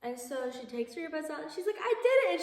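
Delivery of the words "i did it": much higher, much louder cheer of triumph; triumphant cry. Retold or imitated. Natalie at the top of the climbing wall, triumphant cry. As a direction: shout it like you just conquered a mountain.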